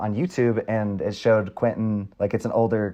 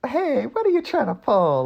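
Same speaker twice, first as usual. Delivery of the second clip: sassy quote; 13 semitones higher than usual. Retold or imitated. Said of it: "I kind of liked her sass".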